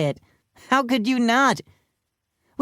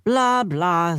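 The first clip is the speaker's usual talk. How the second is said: droning lecturer; 2 semitones higher than usual. A narrator's reading. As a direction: drone on like a tedious professor. Lecture drones on.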